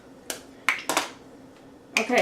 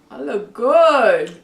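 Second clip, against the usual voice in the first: sassy drag queen; 11 dB louder, slower than usual. Acted. Sassy self-praise.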